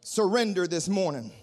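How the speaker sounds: ordinary delivery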